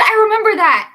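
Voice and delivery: excited outburst. Real, not acted. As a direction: shout the memory back gleefully. Excited recall.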